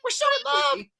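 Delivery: high, slow, whiny lovestruck wail; mock lovestruck Anna. Mocking Anna to friends, high whiny wail. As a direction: wail it like a besotted princess. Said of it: "she's like".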